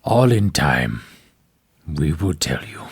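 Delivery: low, hushed menacing captor; low orc captor voice. Acted.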